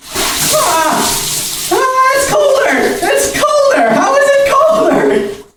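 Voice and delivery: high shout, laughing in shock; shouting from cold. Real, not acted. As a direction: yell at the freezing water. Third bucket of ice.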